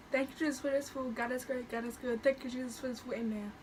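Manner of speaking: soft, hushed mealtime prayer. Real, not acted. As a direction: say grace quietly. Saying grace.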